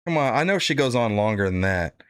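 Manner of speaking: normal talking voice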